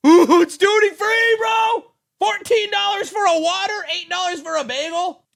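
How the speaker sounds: high, loud, slowed sarcastic yell; mocking the duty-free sales pitch; mocking shout. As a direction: scoff at the airport prices. Mocking airport prices.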